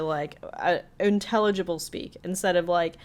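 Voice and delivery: ordinary talk, nothing marked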